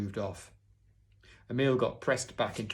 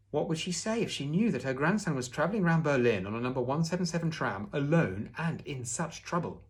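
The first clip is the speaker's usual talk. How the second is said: worried wondering; 5 semitones higher, 4 dB louder than usual. A narrator's reading. Boy imagines grandmother's alarm at his trouble.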